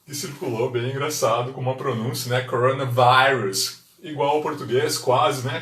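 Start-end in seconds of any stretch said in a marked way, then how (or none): 2.4-3.8 coronavirus with the I said as "ai", slowly; slow careful coronavirus, vai-rus; English coronavirus. Shown as a demonstration. Teacher modelling coronavirus for viewers, slow and clear. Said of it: "o I aqui terá som de I".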